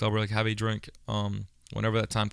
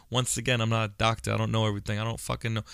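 usual speaking voice

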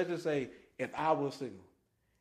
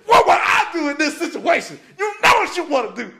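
high, loud, tempting devil; little devil voice; 12 semitones higher, 14 dB louder than usual. Retold or imitated. Shoulder devil urging him on, high loud tempting voice. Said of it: "the little devil showed".

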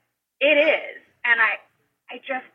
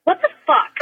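laughing disbelief. Real, not acted.

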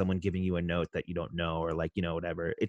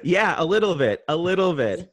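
laughing; 7 semitones higher, 5 dB louder than usual. Real, not acted. Laughing at headset joke.

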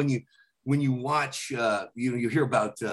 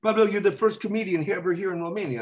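put-on Romanian accent; 8 semitones higher than usual. Romanian promoter greets the comedian, Romanian accent. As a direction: play the Romanian host. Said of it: "the guys go".

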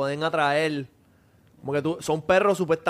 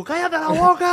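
high, louder hammed-up shout; mock yell; 13 semitones higher, 5 dB louder than usual. Mocking his friend yelling at the protective dog, a hammed-up high yell. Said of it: "Tu perra advirtiéndote y tú".

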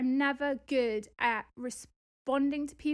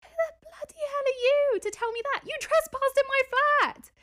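much higher and louder, outraged comeback; indignant retort; 11 semitones higher, 5 dB louder than usual. Retold or imitated. Answering the plumber's nasty email, outraged and loud. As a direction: fire back like you can't believe his nerve. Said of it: "It's like".

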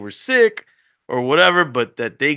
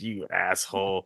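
mouthed insult; 7 semitones lower, 11 dB quieter than usual. Retold or imitated. Wife's look, mouthed insult.

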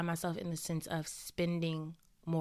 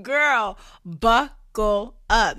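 much louder, slow, hyped buckle up; 3 semitones higher, 12 dB louder, much slower than usual. Acted. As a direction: really sell the warning, loud.

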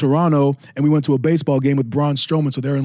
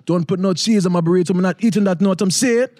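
put-on higher, lively Jamaican accent; 4 semitones higher than usual. Ordering at Taco Bell speaker, a Jamaican accent. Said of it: "I just put on the Jamaican accent".

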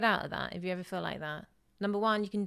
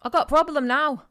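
put-on loud, higher-pitched English accent; 5 semitones higher, 10 dB louder than usual. Caller rings the front desk with a problem, loud English accent. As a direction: play an irate English-accented hotel caller. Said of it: "That was a really good accent".

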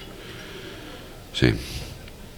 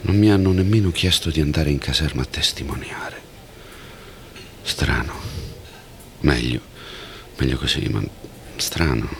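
whispered musing; 5 semitones lower than usual. Acted. Thinking aloud after the event.

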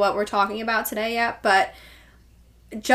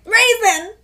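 excited squeal; 10 semitones higher, 6 dB louder than usual. Real, not acted. Excited over dog's old name.

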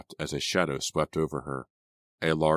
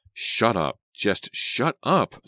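higher, slow, angry order; demanding; 7 semitones higher, 4 dB louder, much slower than usual. A narrator's reading. Slow exasperated command. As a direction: Selena silencing both boys, angry. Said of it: "Selena demanded".

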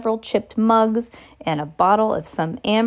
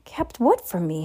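usual speaking voice

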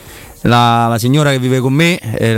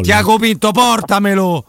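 excited shout; 8 semitones higher than usual. Real, not acted. Cheering a goal live.

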